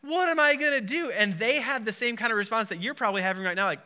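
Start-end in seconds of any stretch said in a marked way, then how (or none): 0.0-1.1 high, panicked cry of a question; mock-panicked plea, jumping high; freaking out. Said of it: "I was literally freaking out".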